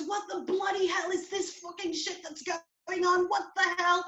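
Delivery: high-pitched indignant British voice; Heidi. Retold or imitated. British girlfriend confronting the police, high British accent. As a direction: imitate an outraged Brit, pitched right up.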